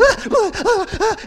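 high, loud wordless whoops; imitated frantic kids. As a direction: mimic overexcited children going wild. Imitates grandkids freaking out.

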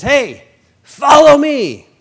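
calling out. Retold or imitated. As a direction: call him over, drawn out and big. Jesus calling out on road.

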